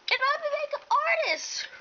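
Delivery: loud, high-pitched wail of sibling jealousy; exasperated outburst. Acted. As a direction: shoot up high and loud in indignation. Jealous rant about her sister.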